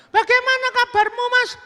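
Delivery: loud, very high falsetto; woman calling out. Acted. Woman calling after departing customer, loud falsetto. Veiled woman greets Burhan.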